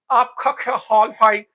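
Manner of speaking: slow, imitated English foreigner's Urdu; mock English accent. Showing how the English greet in Urdu, mock English accent. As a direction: mangle the Urdu like an English speaker, drawn out. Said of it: "الٹی سیدھی ہماری اردو انگریزی کی ٹانگ توڑ دیتے ہیں".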